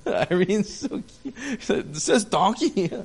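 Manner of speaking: giggling through the words; laughing. Real, not acted. Pastor amused by the donkey verse, laughing. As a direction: laugh while saying it.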